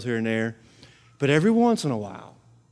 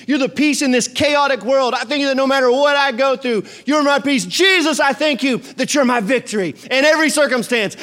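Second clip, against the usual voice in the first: high, loud fervent prayer; fervent preaching prayer; prayer demo; 9 semitones higher, 4 dB louder than usual. Shown as a demonstration. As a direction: preach the prayer with full force. Demonstrates praise prayer.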